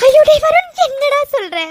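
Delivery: alarmed cry. Acted. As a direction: cry out in shock at bad news. Grandmother hears phone is off.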